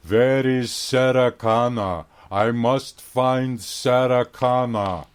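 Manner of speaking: put-on slow, loud Austrian-accented Terminator voice; Arnold Schwarzenegger impression. Clerk mocking the German customer, slow Austrian Terminator voice. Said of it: "my Arnold Schwarzenegger imitation".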